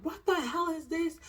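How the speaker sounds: high pitched outraged question; demanding an answer from the cheating fiancé; furious demand. Retold or imitated. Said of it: "I'm ballistic".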